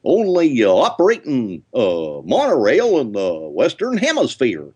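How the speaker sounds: high, goofy cartoon dog spiel. Shown as a demonstration. Host asks him to do the Goofy monorail spiel, in Goofy voice. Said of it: "can you still do the monorail spiel".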